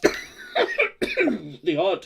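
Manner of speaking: coughing through words. Real, not acted. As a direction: hack out a cough mid-word. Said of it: "Excuse me".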